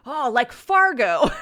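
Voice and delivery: loud, high exclamation, drawn out slowly; excited recognition. Retold or imitated. Friend reacting to the Minnesota accent idea, excited. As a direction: imitate a friend's big, delighted realization.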